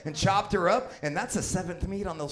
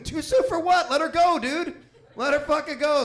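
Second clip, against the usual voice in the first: high, loud exasperated yell; loud comic rant, pitched way up; ranting shout; 10 semitones higher, 5 dB louder than usual. Real, not acted. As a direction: blast it at the hypocrites.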